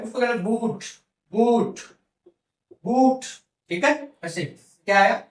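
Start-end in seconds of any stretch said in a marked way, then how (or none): 0.4-3.2 long drawn "oo" in "बूट"; "बूट" as a teacher's long-vowel demo. Shown as a demonstration. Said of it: "अब बड़ा ऊ है".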